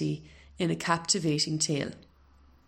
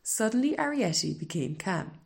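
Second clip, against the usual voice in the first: ordinary delivery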